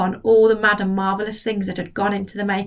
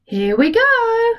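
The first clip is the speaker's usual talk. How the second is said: high, slow excited cry; cried out; 13 semitones higher, 4 dB louder, slower than usual. Acted. George cries out as he gives the medicine, high and eager. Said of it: "George cried out".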